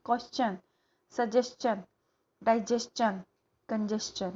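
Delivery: क्वेश्चन, सजेशन, डाइजेशन, कंजेशन said with a clear "chan" ending; chun ending. Shown as a demonstration. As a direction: end each word on a crisp "चन". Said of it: "वो चन का आना चाहिए". (सही उच्चारण, "चन" की ध्वनि)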